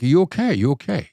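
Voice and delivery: gentle concerned check-in; calm hostage negotiator. Retold or imitated. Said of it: "catch him off guard".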